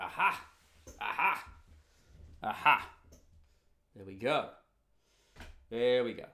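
loud, gleeful aha, repeated; triumphant aha. Retold or imitated. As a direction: crow it like you caught them out. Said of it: "I just went".

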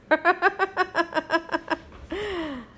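a high burst of laughter; amused laugh. Real, not acted. Laughing at the idea of squabbling Congress, high amused laugh.

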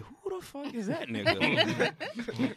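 confused voice. Retold or imitated. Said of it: "I'm just like".

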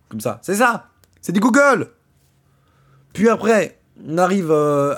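ordinary talk, nothing marked

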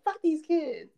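mock Issa. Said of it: "when she threw that baby and was like".

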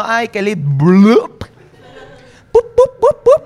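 high, loud cartoon pups; mock sinking sounds. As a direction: voice the woman going under like a video game. Imitating sinking woman and sounds. (imitando sonidos de videojuego, agudos y fuertes)

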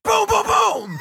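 high, drawn-out boom sound effects; cartoonish explosion noises; imitated booms. Shown as a demonstration. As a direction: act out a blast with the voice. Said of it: "your sperm goes ch-ch".

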